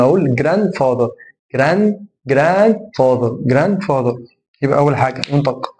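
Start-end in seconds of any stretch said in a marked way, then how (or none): 0.3-4.2 "جراند فاذر" as "gran-father", D left out; silent D. Shown as a demonstration. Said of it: "اكن الدي مش موجود".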